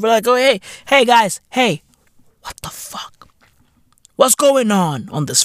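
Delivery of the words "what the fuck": whispered disbelief. Real, not acted. Comedian whispering in disbelief at the audience. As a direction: breathe it out stunned. Disbelief after NPC bit.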